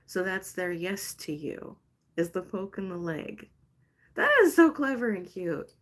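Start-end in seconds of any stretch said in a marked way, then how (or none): 4.1-5.7 delighted squeal. Real, not acted. Delighted reaction to the answer.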